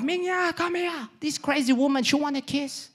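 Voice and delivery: very high-pitched agitated call; calling his wife. Retold or imitated. Man calling his wife about crazy woman, high agitated complaint. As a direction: summon help in a high, complaining voice. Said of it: "And he calls his wife".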